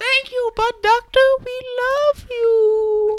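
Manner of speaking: very high squeaky chorus voice, last words drawn out; adoring fans. Acted. Grateful patients thanking their butt doctor, very high squeal. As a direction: squeal it in mock adoration.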